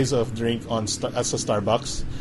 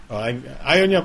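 ordinary talk, nothing marked

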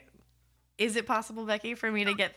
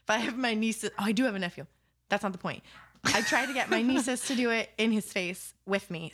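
normal talking voice

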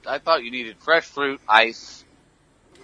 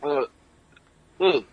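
strained after coughing fit; words after a choke; choking; 4 semitones higher, 5 dB quieter than usual. Real, not acted. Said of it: "Went down the wrong pipe".

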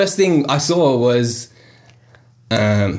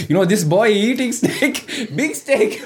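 slow, higher mock of dad tattling; a hint of a scandalised dad, laughing; slow mock gossip voice; mimicking dad; 9 semitones higher, slower than usual. Dad telling uncles, mocking.

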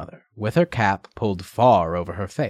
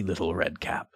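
lower, softer wolf greeting; low sly wolf voice; 5 semitones lower, 9 dB quieter than usual. Acted. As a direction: lure her gently, low.

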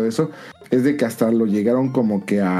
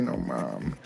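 stunned whisper; 11 dB quieter than usual. Retold or imitated. Stunned reaction to special sushi.

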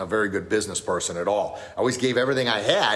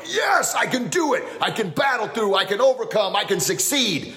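much higher, loud, slowed battle cry; kids' yell; 11 semitones higher than usual. Retold or imitated. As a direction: shout it like a kid at practice. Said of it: "loud kiaps and yelling".

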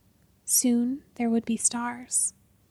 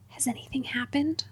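hushed, breathless whisper; 8 dB quieter than usual. Acted. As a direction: whisper it so no one hears. Hiding in the dark cellar.

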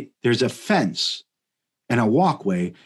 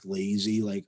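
ordinary talk

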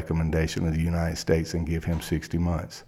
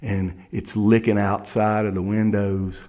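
ordinary talk, nothing marked

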